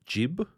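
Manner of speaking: imitated mispronunciation of jib; jib with soft g. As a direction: say jib with a j sound. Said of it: "he pronounced".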